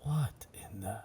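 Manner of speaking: hushed whisper of disbelief. Acted. Captain whispering at the strange floating shape. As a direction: breathe it out, barely voiced. Pirate sees something strange.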